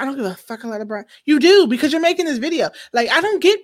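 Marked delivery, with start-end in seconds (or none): 0.0-1.0 quick, softer mock of Karen; quick mocking imitation of Karen; mocking her. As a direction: rattle off her line mockingly. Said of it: "talk about some".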